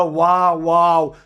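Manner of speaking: low, drawn-out mock wow; mock admiration. Teacher imitating a student praising a rival teacher, mock amazed. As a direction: draw out each wow in hammed-up awe. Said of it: "তুমি বলো".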